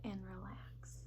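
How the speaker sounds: soft breathy whisper. A narrator's reading. Teacher calming the class, whispered. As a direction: let the word melt into a whisper.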